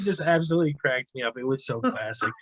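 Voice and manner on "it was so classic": ordinary talk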